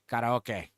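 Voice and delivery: karaoke as ka-ta-o-ke; Japanese pronunciation. Shown as a demonstration. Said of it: "it's pronounced".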